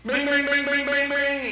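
high, loud repeated bings; imitated dinner bell. Preacher mimics Jesus ringing the dinner bell, loud clanging. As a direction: clang it out on one ringing pitch. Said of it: "Jesus was ringing the dinner bell".

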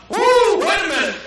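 high, loud whoop of surprise, then catching yourself; amazed whoop. Retold or imitated. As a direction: gasp out a delighted discovery. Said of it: "you start going".

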